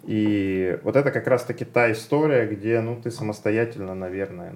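ordinary talk

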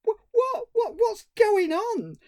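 high, slow Cockney what's going on; mock Cockney panic. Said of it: "this Cockney sidekick".

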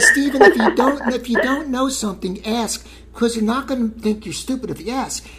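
squeaky high mom impression. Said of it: "But she said".

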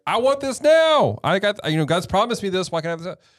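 high, whiny mock demand; mock tantrum, pitched high; impatient demanding. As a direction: imitate someone insisting on it immediately. Acts out impatient people's demands.

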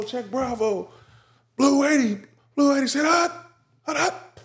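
high, fast excited audible calls at the line; quarterback calling cadence. Retold or imitated. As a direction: bark out play calls like Peyton Manning. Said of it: "He was like".

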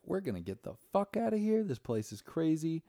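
high-pitched imitation of appalled people fleeing; mock alarmed outrage. Retelling the UK couple wanting out, mock alarmed outrage.

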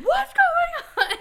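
high, loud, drawn-out demand; alarmed yell. Retold or imitated. Partner demands to know what happened, exasperated yell. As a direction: imitate a baffled partner yelling. Zach's alarmed question, acted out.

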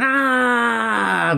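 high, drawn-out imitated scream; long high scream without much volume; mock scream. Retelling a woman grabbed by the hair, mock scream. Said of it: "starts screaming".

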